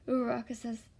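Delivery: ordinary delivery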